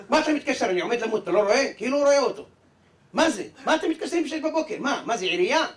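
loud, high, furious yelling down the phone; angry shout. Acted. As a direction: bark at the caller for waking you. Grumpy man woken by phone call. (צעקה כועסת וגבוהה בטלפון)

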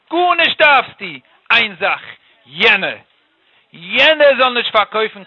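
loud, slow, hammered-out words; incredulous emphasis. Acted. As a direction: punch "jene" loudly, each phrase set apart. King marvels at the petty request.

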